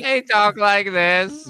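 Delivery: high, slow alien impression; stretched-out, high-pitched alien voice; creepy alien voice. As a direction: talk like a creepy movie alien, drawn out. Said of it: "your, your creepy voice".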